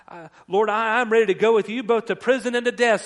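playing Peter rushing to pledge loyalty; eager pledge.

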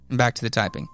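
ordinary talk

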